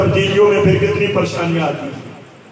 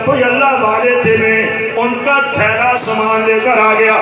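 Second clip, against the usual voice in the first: louder, sung-out sermon storytelling; preaching at full force; 2 semitones higher, 6 dB louder than usual. Acted. Preacher telling the congregation a moving story, chanting.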